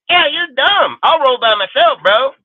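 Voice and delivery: sudden high, loud retort; heated outburst. Real, not acted. Ranting streamer fires back at critics, heated. Angry rant at other streamers.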